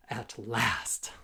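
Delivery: plain reading voice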